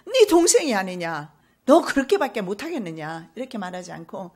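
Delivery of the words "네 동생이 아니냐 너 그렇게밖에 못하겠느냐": a louder, scolding father; a father scolding his greedy son; rebuking father. Acted. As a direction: scold him like a stern father. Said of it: "이렇게 책망하잖아요".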